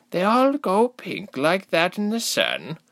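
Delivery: villager voice. Acted. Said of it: "one voice answered".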